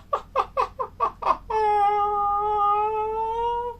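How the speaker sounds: high mad villain cackle; evil cackle. Acted. Spooky host cackling. (risa malvada)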